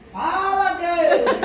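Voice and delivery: loud, high Pentecostal cry; imitated church shout. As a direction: shout it like a fervent congregant. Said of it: "o povo grita assim".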